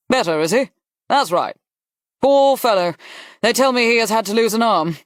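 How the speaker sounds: brisk Duke, rising into high sympathy. Acted. Duke hearing of the wounded officer, briskly sympathetic. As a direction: pity the poor fellow heartily. Plays the Duke about the patient.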